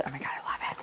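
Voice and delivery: soft unvoiced whisper. Real, not acted. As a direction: whisper it lovingly. Whispered delight.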